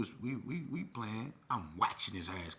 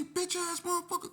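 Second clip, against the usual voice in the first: very high, squealed insult; retold curse in a high, strained voice; outraged cursing; 4 dB louder than usual. Youth cursing back at the staffer, outraged.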